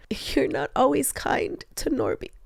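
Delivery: hushed, voiceless retelling of his words; whisper. Retold or imitated. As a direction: breathe it out without voice. Brother's hard words retold.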